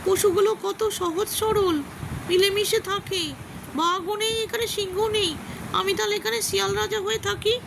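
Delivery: a crafty jackal plotting to be king; scheming fox. Acted. Fox speaks alone in forest. (উঁচু গলায় ধীর, চালাক শিয়ালের স্বর)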